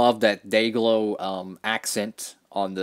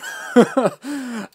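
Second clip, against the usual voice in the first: high breathy chuckle; a bright burst of laughter; amused laugh; 12 semitones higher, 5 dB louder than usual. Real, not acted. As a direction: laugh at your own joke. Laughs at his own jab.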